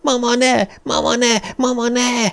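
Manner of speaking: put-on high, desperate child's scream; screaming in terror. A narrator's reading. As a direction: shriek it like a terrified boy. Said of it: "Jimmy sikoltani kezdett". (rémült gyerek sikoltozása)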